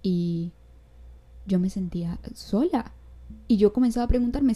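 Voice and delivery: normal talking voice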